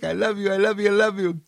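very high, doting little voice; shower love in a high put-on voice; gushing adoration. Blessings of love to each cell, high gushing adoration. Loving words to one's body cells.

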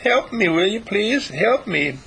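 high, tender little bird voice pleading; tender woesome cheep. Acted. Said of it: "tender, woesome cheep".

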